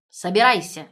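loud, abrupt command; barked order. A narrator's reading. Mother-in-law orders her son-in-law off, barking. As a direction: bark it out like an angry mother-in-law. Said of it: "гаркнула тёща".

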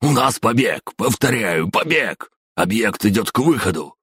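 high loud alarm shout; shouting into radio. Acted. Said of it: "закричав в неё".

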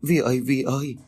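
high, tearful calling of a name; sobbing call. A narrator's reading. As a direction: call for a lost child, near tears. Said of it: "chợt sụt sùi khóc".

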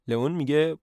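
usual speaking voice

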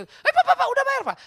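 very high, loud call; loud call that the bill is paid. Retold or imitated. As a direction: shout across to him. Calling waiter about payment.

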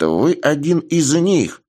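put-on high, slow old man exclamation; a lightly voiced old man, drawn out; old man exclaiming. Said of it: "воскликнул старичок".

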